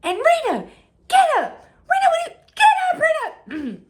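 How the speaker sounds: high, loud frantic mom yell, fast; mother yelling. Retold or imitated. Mother yelling at her daughter to get up, high loud shout. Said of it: "All I hear is".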